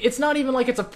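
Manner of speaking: ordinary talk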